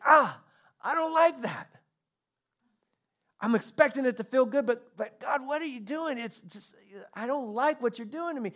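high, startled yelp into a fretful complaint; indignant protest. Acted. Cat complains to God about being petted wrong, high-pitched protest.

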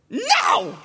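put-on high, loud toddler "no"; imitated yelling. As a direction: yell it like a toddler refusing. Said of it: "he's yelling the whole time".

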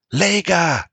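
high loud desperate shout. A narrator's reading. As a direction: call her name in panic. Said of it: "Bran shouted".

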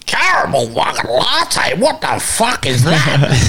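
slow, drawn-out mock disbelief; incredulous old workmate. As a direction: imitate an old bloke appalled by the order. Retold old workmate mocking coffee order.

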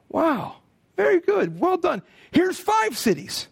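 high, delighted praise from the master. Acted. Master praising the servant who made five pounds, high and delighted. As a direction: beam as you reward him.

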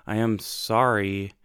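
ordinary delivery

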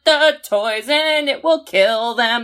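slow, high, drawn-out ominous line; mock dread. Viewer imagining the toys, slow mock ominous. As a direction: spell out the doom slowly. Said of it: "you're like".

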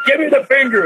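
the cop blurts the punchline demand; imitating the officer. Wrestler retells the officer's slip, high insistent mock order. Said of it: "and he said".

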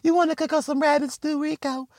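very high, sweet airhead voice; mock naive woman. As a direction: gush like a gullible girlfriend, way up high. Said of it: "She's still like".

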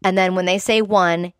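ordinary delivery

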